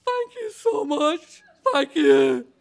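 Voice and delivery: high, slow, choked with laughter and tears; overwhelmed thanks, almost crying; tearful gratitude. Retold or imitated. Grateful man thanking the landlord, almost in tears. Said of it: "almost in tears".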